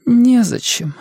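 high, weak voice of a patient; an ailing mother comforting in a high voice; frail mother. Acted. Mother consoles her son from hospital bed, frail.

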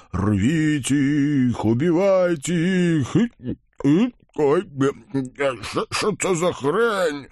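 very slow, heavy monster growl. Acted. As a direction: drawl orders like a giant. Viy roaring then confused.

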